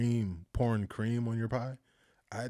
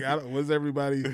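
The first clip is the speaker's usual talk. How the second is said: usual speaking voice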